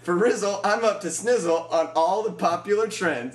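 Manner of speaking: high, loud, slow hip slang; slang dude voice. Retold or imitated. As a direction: play a try-hard hip kid. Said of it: "All I know is".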